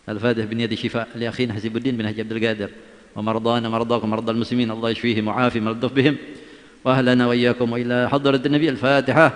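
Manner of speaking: ordinary talk, nothing marked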